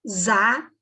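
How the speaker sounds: "za" drawn out with a buzzing z sound; isolated syllable. Shown as a demonstration. Teacher pointing out S sounding as Z, "za" isolated. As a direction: say "za" slowly, standing on its own. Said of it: "a sílaba".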